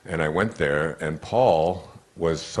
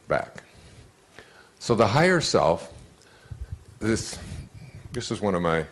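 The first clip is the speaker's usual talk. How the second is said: ordinary talk, nothing marked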